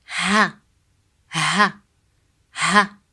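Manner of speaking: "Ha" with a light breath of H; short "Ha", H as in house; English-style H. Shown as a demonstration. Teacher drilling Arabic ha, plain English H. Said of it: "just like the English H sound".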